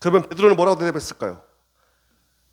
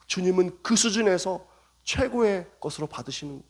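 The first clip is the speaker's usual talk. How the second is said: ordinary delivery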